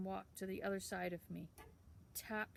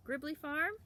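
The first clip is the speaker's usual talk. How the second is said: higher, louder call across the shop; 8 semitones higher, 6 dB louder than usual. A narrator's reading. Town boy calling back to Sam, loud and high. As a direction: shout back as you leave.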